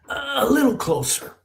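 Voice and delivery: high, slow thin voice; beckoning grandmother. Retold or imitated. Grandmother luring grandson nearer, high beckoning. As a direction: coax him nearer, high and drawn out.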